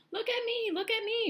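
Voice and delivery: very high, loud excited cry; excited shout. A narrator's reading. Centipede freed from the paint, very high excited shout. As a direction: shout with joy. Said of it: "shouted the centipede excitedly".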